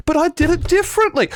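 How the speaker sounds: high whiny protest; whiny little brother. Retold or imitated. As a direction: whine it like a kid caught out. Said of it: "You did the same thing".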